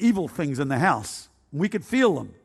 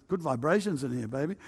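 laid-back hippie, softer than usual; hippie talk; 3 semitones lower, 7 dB quieter than usual. Retold or imitated. Hippie telling his friend Chris about the feeling, mellow hippie talk. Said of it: "we talk like that".

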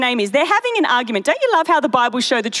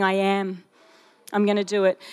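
quiet divine reassurance; 5 semitones lower, 4 dB quieter than usual. Acted. God speaking beside you.

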